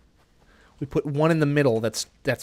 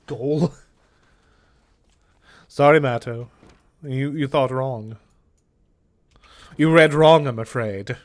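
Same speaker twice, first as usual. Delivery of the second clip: theatrical gloating villain voice; lightly hammed camp villain taunt; camp anime villain; 4 dB louder than usual. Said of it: "camp anime villain".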